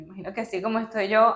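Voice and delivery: ordinary talk, nothing marked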